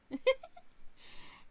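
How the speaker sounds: high, wheezy giggle; real giggle. Real, not acted. Game player laughing after a reading slip, real giggle. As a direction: laugh at your own blunder.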